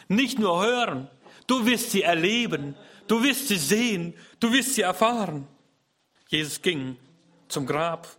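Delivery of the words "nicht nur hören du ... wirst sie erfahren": higher, louder preaching with stress on wirst; fervent preaching. Acted. Preacher promising the congregation, fervent preaching. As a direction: proclaim it to the hall.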